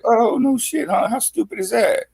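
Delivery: squeaky high cartoon voice; high mock voice. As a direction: pitch way up, mocking. Jellyfish laughing, high voice.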